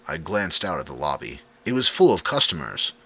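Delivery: ordinary delivery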